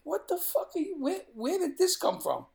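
high, astonished outburst; a stunned child asking in disbelief; shocked kid. Retold or imitated. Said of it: "I'm saying".

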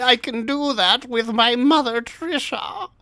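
high, put-on royal voice; hammy high-pitched queen impression; Queen Elizabeth voice. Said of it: "Liz said".

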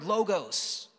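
ordinary talk, nothing marked

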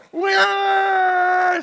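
high, loud mock baby wail. Pastor mimicking his daughter crying after falling, loud wail. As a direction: imitate a toddler crying.

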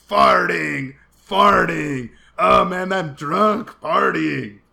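put-on very loud drawn-out drunken holler; mimicked yelling. Imitating bad boy running circles on the street, yelling. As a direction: bellow each word out like a rowdy teen. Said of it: "he was yelling".

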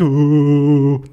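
long, steady "uh" groan; bear's moan. Acted. Shocked bear cannot move, long helpless groan. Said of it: "Und der Bär hat gesagt".

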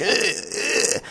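high, strained straining noises; squeezed "eh" grunts of effort; imitated exertion grunts. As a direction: mimic a girl struggling through sit-ups. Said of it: "she's like".

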